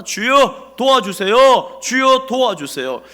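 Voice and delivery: slow, loud, high pleading cry to the Lord; crying out in prayer. Acted. Nehemiah crying to God in hardship, loud slow plea. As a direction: wail it out like a desperate prayer.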